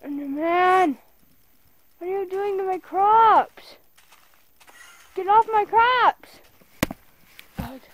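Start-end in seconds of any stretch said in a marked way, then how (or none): 0.1-6.4 loud, high, outraged yell; yelling at the enderman. Real, not acted. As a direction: shout it at the mob wrecking your field. Yelling at enderman wrecking crops.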